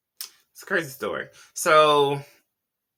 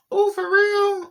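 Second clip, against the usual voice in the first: mock surprise; 6 dB louder, slower than usual. Mock surprise at gossip headline.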